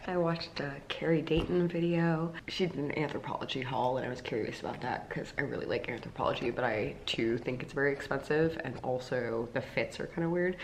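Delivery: soft morning chat, slipping towards a whisper; talking quietly. Real, not acted. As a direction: keep the voice down for someone asleep. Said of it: "I'm talking quietly 'cause Joff's sleeping".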